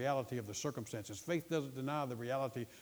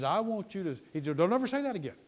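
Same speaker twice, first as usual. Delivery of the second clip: higher, louder admonition; emphatic, pitched-up warning; urgent command; 6 semitones higher, 5 dB louder, faster than usual. Retold or imitated. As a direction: act out a minister forbidding it firmly. Acts out minister's stern command.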